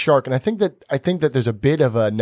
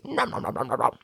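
shark munching noises; 8 dB quieter than usual. Acted. Storyteller imitates the shark eating fruit, playful munching. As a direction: imitate a shark gobbling, quietly. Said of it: "and the sharks goes".

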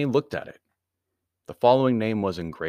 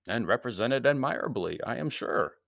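usual speaking voice

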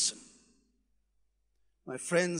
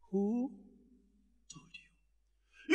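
almost unvoiced, much softer; hushed, breathy refrain; 5 semitones lower, 11 dB quieter, much slower than usual. God asking the congregation, hushed refrain. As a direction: breathe the question out.

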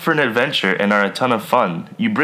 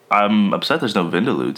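ordinary talk

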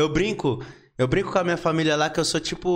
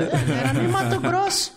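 much higher, laughing mock correction; mocking his team; 10 semitones higher than usual. Mimicking his team correcting him, high and laughing. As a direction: tease the crew in a squeaky put-on voice while laughing. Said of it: "minha equipe depois fala".

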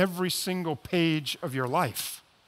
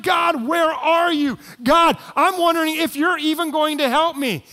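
desperate cry to God; 8 semitones higher, 5 dB louder than usual. Pretend. Voicing a desperate prayer to God.

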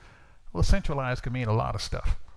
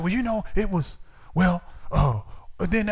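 higher, halting search for an answer; stumped stammering; 5 semitones higher, 3 dB louder than usual. Retold or imitated. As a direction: fumble for examples, caught out. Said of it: "I couldn't come up with".

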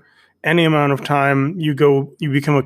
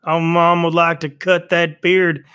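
Carol Baskin cashier; 2 semitones higher than usual. Pretend. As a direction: coo at him like a sleazy clerk. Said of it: "I'll be you as Carol Baskins as the cashier".

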